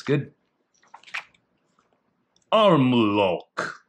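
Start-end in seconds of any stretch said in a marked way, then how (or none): 2.5-3.8 "Arm lock" with a mock Brazilian accent, louder; put-on accent. Shown as a demonstration. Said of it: "That's my accent".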